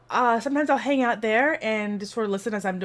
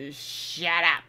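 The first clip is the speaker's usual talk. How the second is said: snarky; 5 semitones lower, 3 dB quieter than usual. Acted. Overtired streamer telling friends off, snarky. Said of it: "get really snarky".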